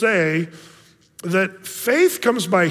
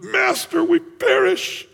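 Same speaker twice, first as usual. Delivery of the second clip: high, drawn-out frightened cry; mock terrified scream; screaming in fear; 13 semitones higher, slower than usual. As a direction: wail like a man going under. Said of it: "we'd be screaming".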